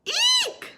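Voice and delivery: very high, loud scream; a piercing mock scream; screamed eek. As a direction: scream as if jolted awake. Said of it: "screamed Goldilocks".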